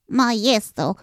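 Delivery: small creature calling out for its master; urgent first cry of Maestro; desperate call. Acted. Little helper calls the sleeping master, desperate. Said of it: "und rief verzweifelt".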